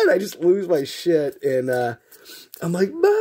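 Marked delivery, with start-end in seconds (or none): 2.9-3.1 loud mock wail for mom; calling for mom. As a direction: imitate a hurt kid yelling for mother. Hurt child calls out for mother.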